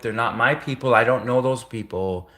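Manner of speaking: ordinary delivery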